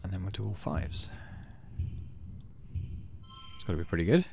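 ordinary delivery

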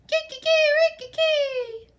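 high, loud cock-a-doodle crow; shrill rooster call. Acted. Rooster crows in story.

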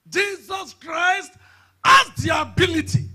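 slow, roaring, high declaration; preaching shout. Acted. Preacher proclaiming Jesus's power, full roar. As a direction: shout it out to the church.

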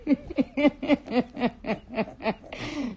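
long chuckling laugh. Real, not acted. Singer amused at her own promise, laughing. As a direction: break into a run of giggles. Laughs between the two takes.

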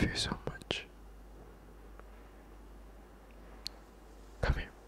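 intimate comforting whisper, close to the mic; hushed boyfriend whisper; soft whisper. Acted. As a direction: whisper reassurance to your girlfriend.

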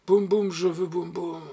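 imperious little plea; ardent, pleading demand. A narrator's reading. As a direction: beg and order at once. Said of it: "d'un ton ardent et suppliant et impératif".

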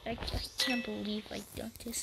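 soft embarrassed whispered goodbye. Real, not acted. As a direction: whisper your sign-off, a little ashamed. Embarrassed sign-off to viewers.

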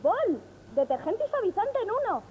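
surprised delight. Acted. Surprised discovery.

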